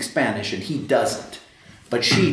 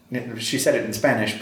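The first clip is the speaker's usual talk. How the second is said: normal talking voice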